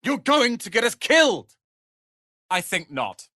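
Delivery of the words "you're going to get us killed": angry accusation. Acted. Accusing companion of danger.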